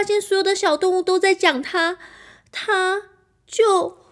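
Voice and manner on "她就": 哭腔. Acted. Narrator acts the princess crying.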